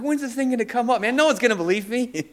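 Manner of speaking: high, loud amazed inner voice; excited retelling. Retold or imitated. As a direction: relive the thrill of waiting. Retelling his own excited thought.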